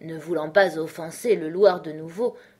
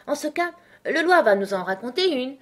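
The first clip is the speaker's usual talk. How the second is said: higher, louder exclamation; crying out; 7 semitones higher, 5 dB louder than usual. A narrator's reading. The pair volunteering the Loir for a story, crying out. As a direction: call it out eagerly, pitched up. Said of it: "s'écrièrent-ils tous les deux".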